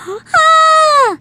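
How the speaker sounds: a sudden high loud cry of relief; relieved shriek. Real, not acted. Streamer back from the bathroom, shrieking with relief. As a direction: let out a loud relieved yelp.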